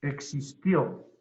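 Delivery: "Existió" slow, exis-TIÓ with the last syllable stressed; stress on final O. Shown as a demonstration. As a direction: land the weight on tió in "Existió". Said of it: "No es existió". ("Existió" pausado, con acento en TIÓ)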